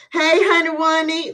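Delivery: high, loud happy hello; excited greeting. Real, not acted. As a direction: call out to a friend. Greeting a viewer.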